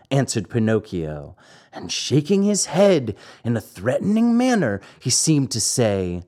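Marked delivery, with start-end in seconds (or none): none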